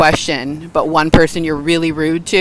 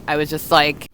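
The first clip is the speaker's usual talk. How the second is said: ordinary talk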